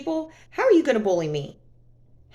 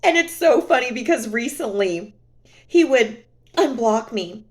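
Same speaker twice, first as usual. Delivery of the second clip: normal talking voice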